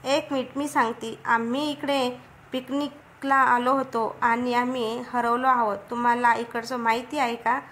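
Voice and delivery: usual speaking voice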